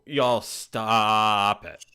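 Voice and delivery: slow, loud "stop" with a long A; drawn-out Southern stop. Acted. Teasing Leslie's Southern spelling, drawn-out "stop". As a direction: stretch "stop" wide and loud. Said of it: "how you write stop".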